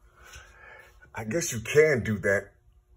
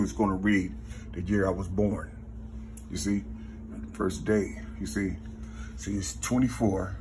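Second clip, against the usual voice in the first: normal talking voice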